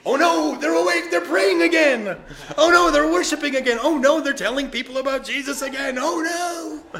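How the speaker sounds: high, loud mock panic of the devil; panicked devil. Preacher mocking the devil's panic, loud high wail. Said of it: "what the devil thinks".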